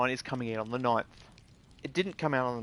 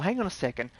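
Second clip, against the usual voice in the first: ordinary delivery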